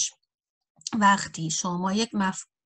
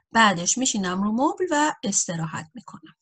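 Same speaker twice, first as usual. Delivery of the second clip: normal talking voice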